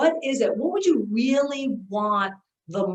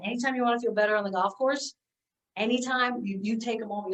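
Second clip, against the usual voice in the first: ordinary talk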